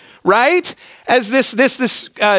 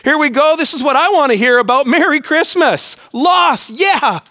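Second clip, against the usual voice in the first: higher-pitched sarcastic cheer; mock enthusiasm; 5 semitones higher than usual. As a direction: play a congregant faking excitement about the sermon topic. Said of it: "you're like".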